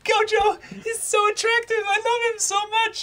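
very high falsetto gushing; hammed-up lovestruck pleading; swooning fans. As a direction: squeal adoringly like a mock fan, loud. Mimics fans begging for Gojo.

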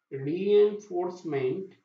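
ordinary delivery

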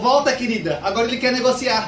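high, loud campy call to a friend; high-pitched camp holler; campy young man. Acted. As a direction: call back theatrically, effeminate and hammed-up. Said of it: "Dois rapazinhos alegres".

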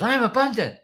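high, loud imitation of a child; mock kid's shout; daughter echoing loudly. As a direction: yell it back like an eager little girl. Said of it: "She goes".

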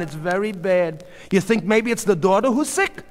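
high, loud, opinionated neighbor; sympathetic busybody neighbor. A narrator's reading. Mrs. Katz gossiping about a breakdown, emphatic and opinionated.

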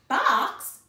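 loud, high, disbelieving question; startled exclamation. A narrator's reading. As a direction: blurt it out in shock. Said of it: "exclaimed Missus Rogers".

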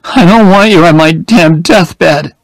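high, loud, sharp dismissal; angry. A narrator's reading. Jenny angrily ordering Oliver away, high and loud. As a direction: throw him out in anger. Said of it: "she said angrily".

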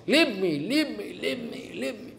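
pleading that fades away to a whisper; begging Krishna to let go, dying away to a whisper; mock desperate plea. A narrator's reading. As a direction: start in a normal voice and sink to breathless whispering. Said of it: "Putana shouting".

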